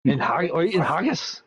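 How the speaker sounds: high, loud hammed-up Irish brogue; a boisterous Irish pub regular; Irish accent. Friends riffing, a rowdy Irish pub regular.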